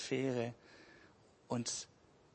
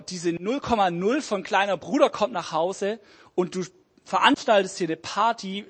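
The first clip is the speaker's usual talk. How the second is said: loud, furious rant at his father; angry outburst; 4 semitones higher, 10 dB louder than usual. Acted. Older son yells at his father about the party. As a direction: snap at the father with pent-up rage. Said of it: "er regt sich auf und schnauzt den Vater".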